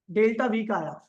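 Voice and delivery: normal talking voice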